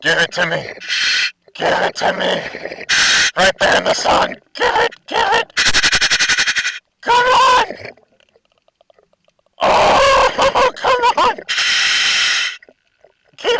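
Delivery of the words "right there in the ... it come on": ordinary talk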